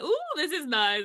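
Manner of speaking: high, loud little coo of delight; delighted scrolling. Retold or imitated. Scrolling social media, a mock delighted coo. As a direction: coo over something lovely you found. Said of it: "the deep dive where you just like".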